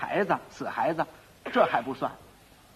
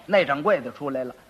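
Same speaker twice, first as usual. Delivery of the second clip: ordinary talk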